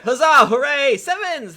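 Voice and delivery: loud, high cheering; hammed-up hurrah. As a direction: cheer it out like a fan. Reading cheering review aloud.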